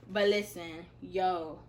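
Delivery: ordinary delivery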